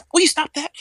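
high mock scolding voice; hammed-up high Dixie; imitating Dixie. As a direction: snap it like an annoyed boss.

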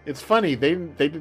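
normal talking voice

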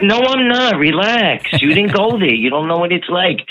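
high-pitched mock protest; defensive retort. Retelling her protest to Nana about the church, defensive. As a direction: push back, voice jumping up, imitating an argument.

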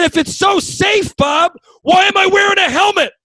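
very high, loud, exasperated yell; furious shouted retort. Retold or imitated. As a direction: scream the question down in panicked outrage. Acted furious retort from the pole.

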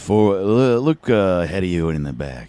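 very low, soft seductive croon. Retold or imitated. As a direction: purr it deep and sexy.

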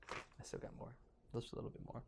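unvoiced teasing whisper; creepy hushed tease; spooky whisper. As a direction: hush it like a secret. Spooky teasing story continuation.

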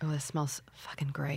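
whispered, very soft approval; hushed delight. Real, not acted. Host sniffing the facial oil, hushed delight. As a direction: murmur it under your breath, savoring the smell.

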